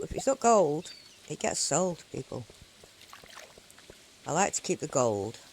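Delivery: ordinary talk, nothing marked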